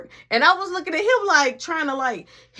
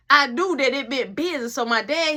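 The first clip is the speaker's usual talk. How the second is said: ordinary talk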